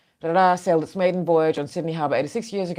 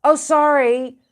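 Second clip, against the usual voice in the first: mock apology; 5 semitones higher, 3 dB louder than usual. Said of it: "And now they're like".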